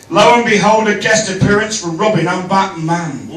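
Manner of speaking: reciting rhyming verse; poem recital. Acted. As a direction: read it like a poem.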